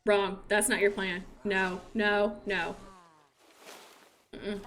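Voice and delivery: low, stern forbidding; mock stern parent. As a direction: forbid the flirting like a strict parent. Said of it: "I saw you flirting".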